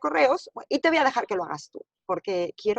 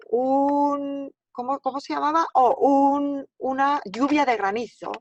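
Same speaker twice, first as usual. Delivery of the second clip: groping for the word, pitched higher; pretend not knowing; 5 semitones higher, 4 dB louder than usual. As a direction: act like a learner searching for vocabulary. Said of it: "estoy haciendo como que no entiendo".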